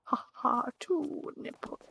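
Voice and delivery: slow, soft hammed-up vampire count; Count parody. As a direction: count like the Sesame Street vampire. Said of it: "The vampire".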